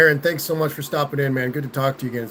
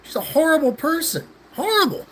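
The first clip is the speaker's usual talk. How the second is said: high indignant retort; 9 semitones higher than usual. Retold or imitated. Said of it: "And I'm like".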